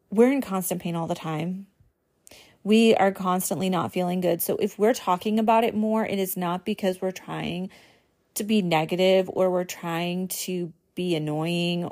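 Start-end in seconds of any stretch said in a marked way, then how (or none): none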